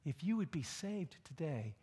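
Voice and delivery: normal talking voice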